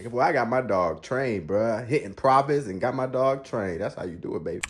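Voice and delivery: ordinary talk, nothing marked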